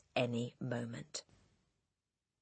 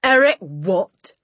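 slow, loud, higher-pitched query; shouting Trunchbull; 4 semitones higher, 13 dB louder, slower than usual. A narrator's reading. Headmistress querying a pupil's name, slow shout. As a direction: shout it slowly in disbelief. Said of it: "the Trunchbull shouted".

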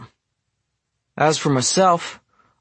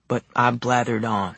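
ordinary delivery, nothing marked

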